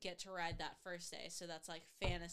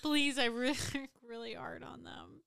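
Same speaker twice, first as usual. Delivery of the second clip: mock begging; 3 semitones higher, 7 dB louder than usual. Joking begging.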